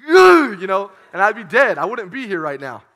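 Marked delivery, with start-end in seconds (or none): 0.1-0.6 loud high grunt of pain; hammed-up groan of being kicked; mock pained grunt. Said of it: "she would be like".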